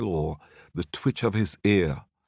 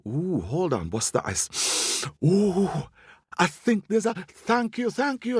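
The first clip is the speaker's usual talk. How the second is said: high, rising thrill of discovery; excited squirrel; 9 semitones higher than usual. Acted. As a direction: gasp it out high, thankful and excited. Squirrel pretends to smell a lion.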